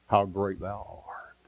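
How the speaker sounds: slow, breathy whisper of wonder; awed whisper. Acted. Preacher whispering praise to the congregation, slow and awed. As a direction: breathe it out in hushed awe.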